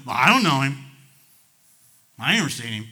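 loud, blurted acted denial; hammed-up brush-off of an accuser; emphatic denial. Preacher as Peter denying Jesus to a girl, emphatic.

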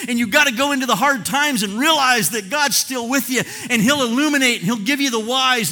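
loud, high, ringing exhortation; full-force preaching. Acted. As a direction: drive the point home to the flock.

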